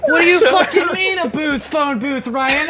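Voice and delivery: high, mildly voiced incredulous friend; lightly mocked exasperation. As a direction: play his buddy's baffled outrage. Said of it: "So Zach was like".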